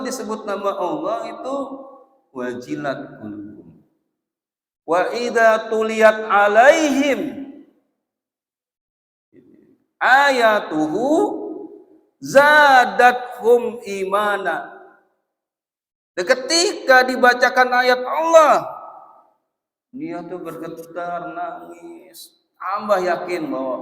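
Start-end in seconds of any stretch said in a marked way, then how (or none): 4.8-14.7 loud, slow, high chanted recitation; preaching recitation. Acted. 16.2-18.8 loud, high preaching; preaching at full force. Acted.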